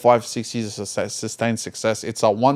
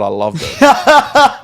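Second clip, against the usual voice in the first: laughing; 13 semitones higher, 8 dB louder, much slower than usual. Real, not acted. Teasing about the jacket.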